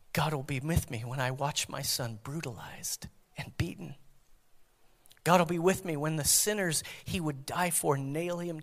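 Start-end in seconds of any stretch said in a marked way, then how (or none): 0.1-4.0 hushed, grieving whisper. Acted. Mary watching her son beaten, whispered. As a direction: barely breathe the words out.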